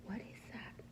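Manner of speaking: soft, frightened whisper; scared whisper. Acted. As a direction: breathe the question out in fear. Scared Pete hears owl.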